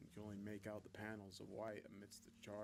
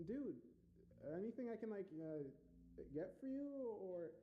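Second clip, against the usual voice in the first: high, halting, awkward offer; concerned roommate; 10 semitones higher, slower than usual. A narrator's reading. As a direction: check gingerly on an upset friend. Roommate awkwardly checks through door.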